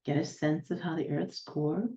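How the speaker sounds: unhurried guiding voice, long silences between instructions; guided meditation. Real, not acted. Healer guiding listeners to ground down to the planet's center, guided meditation. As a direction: lead them step by step, letting each image settle.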